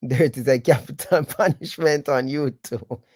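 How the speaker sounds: words broken up by chuckles; laughing. Real, not acted. Host joking to listeners about the term dirt, laughing. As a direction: say it through laughter.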